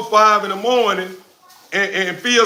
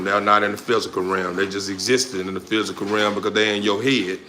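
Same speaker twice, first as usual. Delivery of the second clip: lower, unhurried preacher-like blessing to the trolls; calm mock sermon; 8 semitones lower than usual. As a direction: talk down to the trolls like a calm pastor.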